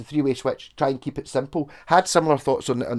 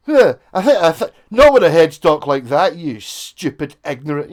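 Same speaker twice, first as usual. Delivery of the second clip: mock abusive commenter; 9 dB louder than usual. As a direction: bark it like a rude commenter. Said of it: "And I got abuse for it".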